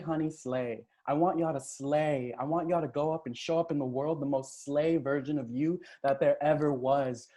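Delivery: ordinary talk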